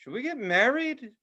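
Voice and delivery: high, flat comic question; deadpan joke proposal. Retold or imitated. Reacting to her odd request, high comic question. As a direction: toss it out as a joke. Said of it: "I was always like".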